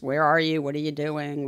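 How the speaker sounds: usual speaking voice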